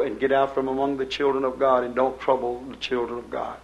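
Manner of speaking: ordinary talk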